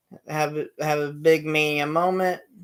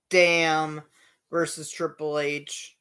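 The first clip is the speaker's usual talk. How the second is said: normal talking voice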